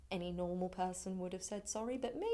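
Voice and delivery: ordinary delivery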